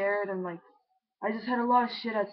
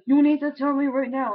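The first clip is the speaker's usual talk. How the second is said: imitated mom, loud and raised; louder, higher mock parental order; retold stern demand; 5 semitones higher, 7 dB louder than usual. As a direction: insist like a fed-up parent.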